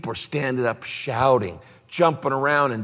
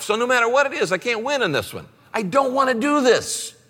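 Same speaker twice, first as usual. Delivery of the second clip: pleading protest; 9 semitones higher than usual. Retold or imitated. Arguing with God, retold.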